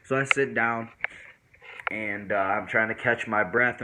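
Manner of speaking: normal talking voice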